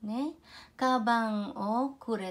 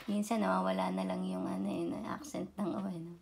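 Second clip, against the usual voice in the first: whispered aside; 3 semitones lower, 7 dB quieter, much faster than usual. Real, not acted. Side remark on dropping o.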